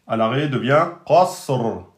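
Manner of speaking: ordinary talk, nothing marked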